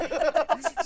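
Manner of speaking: high, loud pained howl; scream of pain. Retold or imitated. Husband unable to speak from cramp, acted scream. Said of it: "screaming in her bed".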